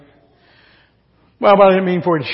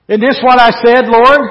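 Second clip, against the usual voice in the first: louder, higher, protesting to God; indignant outcry; 5 semitones higher, 9 dB louder than usual. Acted. Jonah complaining to God, indignant and loud. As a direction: Jonah throwing it back at the Lord.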